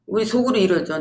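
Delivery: ordinary talk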